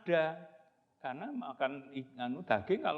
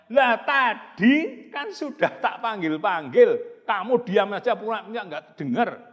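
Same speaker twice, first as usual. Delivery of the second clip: retort; 8 semitones higher, 12 dB louder than usual. Acted. Brother retorting to Bagong.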